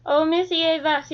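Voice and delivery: ordinary delivery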